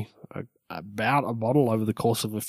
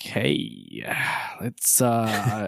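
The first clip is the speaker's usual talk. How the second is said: mock seductive come-on. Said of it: "all of a sudden I'm like".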